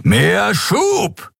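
high, forceful captain's order; shouted command. A narrator's reading. Captain orders his engineer during the chase, shouted command. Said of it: "befahl Kapitän Sron".

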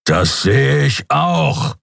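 very slow, drawn-out irritated growl; rumbling growl. A narrator's reading. Captain answers his pilot's obvious report, growling. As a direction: growl each word with menace. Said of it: "grollte Sron".

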